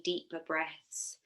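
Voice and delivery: ordinary talk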